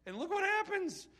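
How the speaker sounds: rising preacher excitement; excited. Real, not acted.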